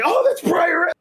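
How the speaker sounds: high, loud, thrilled cry; excited shout. Retold or imitated. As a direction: yell it out in disbelief. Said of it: "and I'm like".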